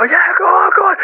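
high, excited egging-on; high mock crowd cheer; imitated cheering crowd. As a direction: cheer them on like a wrestling crowd. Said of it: "People are cheering and go".

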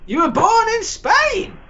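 can't believe it, pitch shoots up; incredulous. Real, not acted. Incredulous reply.

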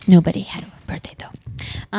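whispered under the breath; hushed little whisper, quick; whispered aside. Real, not acted. Aside about no birthdays.

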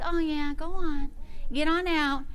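soft, limp shooing; quiet, feeble mock dismissal; mock half-hearted. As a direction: shoo it away without meaning it. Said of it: "You've gotta get a little feisty".

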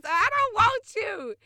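high, loud mock yell of refusal; loud rejection. Telling the ex off, a high loud mock yell. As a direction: throw the rejection out at full volume.

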